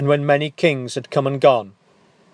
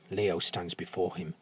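ordinary delivery

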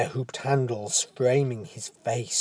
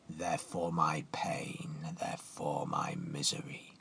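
giant's whisper; 9 semitones lower, 12 dB quieter than usual. Acted. Giant answers Leo sadly.

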